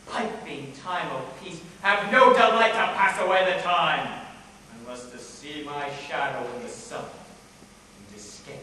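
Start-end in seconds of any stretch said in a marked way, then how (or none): 1.8-4.2 loud, scornful declaiming; sudden theatrical surge of volume; bitter flare-up. Acted. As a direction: burst out in discontent.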